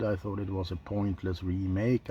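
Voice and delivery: usual speaking voice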